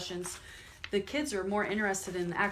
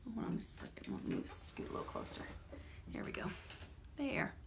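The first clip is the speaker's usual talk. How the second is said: quiet aside; 9 dB quieter, much faster than usual. Real, not acted. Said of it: "aside while shifting closer to children".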